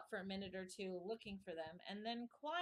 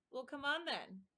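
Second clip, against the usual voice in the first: roared invitation; 6 semitones higher, 3 dB louder than usual. A narrator's reading. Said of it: "roared the queen".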